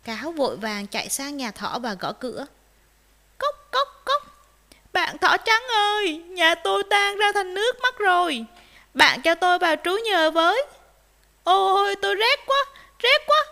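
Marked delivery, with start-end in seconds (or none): 3.4-4.2 high, brisk spoken knock-knock; knocking noise voiced. Fox knocks at rabbit's door, voiced knocking. 4.9-13.4 high, loud begging for shelter; urgent plea. Acted. Freezing fox asks rabbit for shelter, urgent high pleading.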